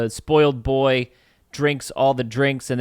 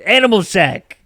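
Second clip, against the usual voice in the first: emphatic joke insistence; 7 semitones higher, 7 dB louder than usual. Pretend.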